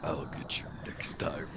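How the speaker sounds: low throaty burp voice, slow; Doctor Claw. Retold or imitated. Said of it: "you have a really good throat voice".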